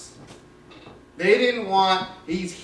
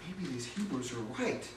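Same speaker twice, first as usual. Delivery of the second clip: trembling awe; 5 semitones lower, 13 dB quieter than usual. Acted. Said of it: "starts trembling and shaking".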